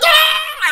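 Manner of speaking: high, loud frightened shriek; panicked scream. Retold or imitated. Kid with bag over head screams, high panic. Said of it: "I'm like".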